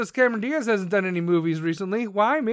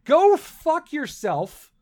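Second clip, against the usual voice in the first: angry retort; 5 semitones higher, 5 dB louder, much slower than usual. Real, not acted.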